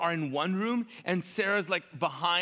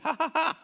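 high mock laugh; acted high-pitched scornful ha-ha; scoffing laugh; 9 semitones higher than usual. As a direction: laugh at the absurd idea, hammed-up. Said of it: "She scoffed at herself".

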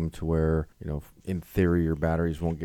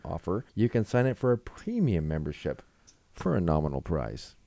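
ad read; 5 semitones higher than usual. Acted. Continues the ad read.

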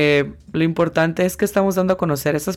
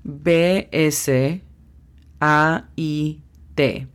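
slow spelled letters; much slower than usual. Shown as a demonstration. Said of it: "La pronunciación fonética es".

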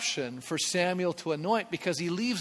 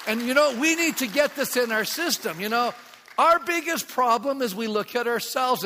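raised, high preaching voice; 6 semitones higher, 5 dB louder than usual. Real, not acted. Pastor urging his congregation, raised preaching voice. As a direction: drive it home to the church.

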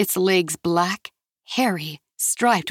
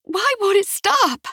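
yelling; 11 semitones higher than usual. Acted. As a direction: scream it over the shaking house. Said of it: "Ixchel yelled".

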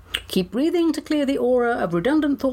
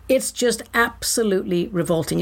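usual speaking voice

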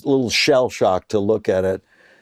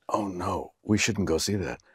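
soft worried warning; 4 semitones lower, 10 dB quieter than usual. Retold or imitated. Wife warning against the play.

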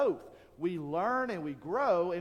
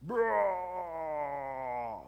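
flexing grunt. Retold or imitated. Bodybuilder grunt again.

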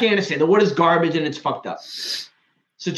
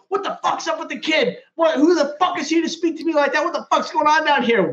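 higher, mock outraged chief; retold chief's rant; 9 semitones higher than usual. Chief yelling at the crew about the kid, mock outrage. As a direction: play the baffled, furious chief, pitched up. Chief losing his mind.

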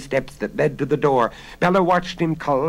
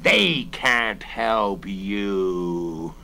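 very slow, sinister taunt; drawn-out villain's gloat, sinking low; menacing robber; 4 semitones lower, much slower than usual. A narrator's reading. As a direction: stretch every word out menacingly. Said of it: "as the voice continued".

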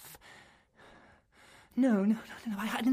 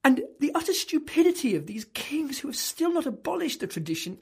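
indignant rant; 8 semitones higher, 6 dB louder than usual. Acted. Furious rant at Spanish customs.

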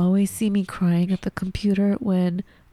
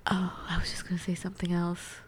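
whisper; 11 dB quieter, faster than usual. Real, not acted. Aside while host waits.

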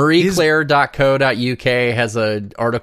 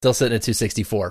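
usual speaking voice

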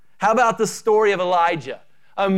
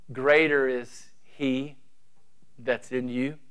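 solemn scripture quote; 4 semitones lower, 7 dB quieter than usual. Acted. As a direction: let each phrase land quietly. Quoting scripture slowly, reverently.

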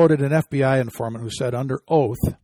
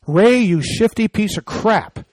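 disgusted insult; 6 semitones higher, slower than usual. Real, not acted. Host insults director after quote.

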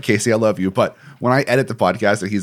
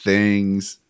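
slowed right down, stretching the words out; drawn out; much slower than usual. Shown as a demonstration. Mocking the co-host's slow Southern talk, drawn out slowly. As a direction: drag each word out like a slow Southern talker. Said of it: "has a way of drawing things out".

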